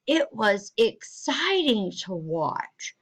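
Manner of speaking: "exciting" drawn out slowly, rising up then dropping; voice rising then falling. Shown as a demonstration. Teacher showing tone change, "exciting" rises then falls. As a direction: lift your voice on "exciting", then let it fall. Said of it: "your voice needed to rise up".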